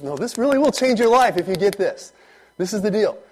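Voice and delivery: earnest, high pleading; insistent. Retold or imitated. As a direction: press the point home to someone not getting it. Acting out pressing advice on someone.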